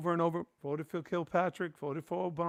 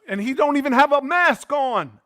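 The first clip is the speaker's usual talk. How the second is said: louder and higher, incredulous; outraged; 9 semitones higher, 11 dB louder than usual. Real, not acted. Host venting to his cohost about the maskless president, outraged and loud. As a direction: hit it with disbelief.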